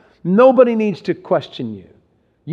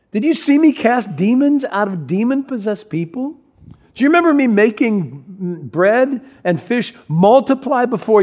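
higher, mock-incredulous questions; incredulous sarcasm; 6 semitones higher than usual. Jesus reminding disciples of his miracles, mock disbelief. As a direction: rub it in, half teasing. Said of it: "sanctified sarcasm".

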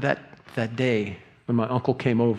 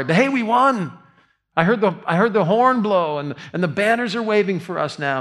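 high, louder cheerful victory news; upbeat dodge; 8 semitones higher, 5 dB louder than usual. Retold or imitated. Messenger to King David, high and eager. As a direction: brush past the question and cheer.

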